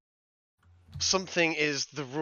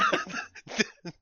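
a high embarrassed squeal of laughter; high squeaky giggle. Real, not acted. Embarrassed after uwu daddy talk.

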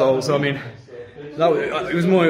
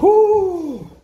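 excited whoop; 6 dB louder than usual. Real, not acted. Squeezing out of tight passage.

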